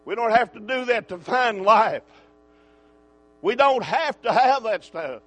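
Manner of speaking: loud, insistent pulpit declaration; emphatic preaching. Real, not acted. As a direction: drive each line home hard.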